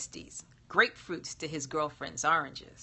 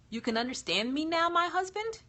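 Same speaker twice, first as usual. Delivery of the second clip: put-on higher, lilting young woman's voice; genie bride voice; 11 semitones higher than usual. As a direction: gently ask your new husband. Genie speaks to her husband.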